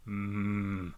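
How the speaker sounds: low, drawn-out Frankenstein-style voice; creepy monster. Retold or imitated. Red-eyed creature closing in on children, low monster drawl. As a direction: savour it slowly like a lumbering monster. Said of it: "läskig varelse som sakta rör sig".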